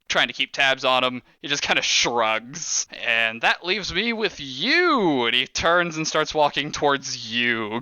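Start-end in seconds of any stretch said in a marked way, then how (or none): 3.0-5.2 slow boss voice. Acted. Crime boss greets the players.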